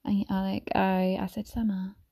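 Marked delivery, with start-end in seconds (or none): none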